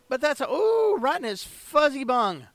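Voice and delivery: high, loud delighted yelp; excited squeal. Real, not acted. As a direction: squeal with glee at the hit. Excited cry at a hit.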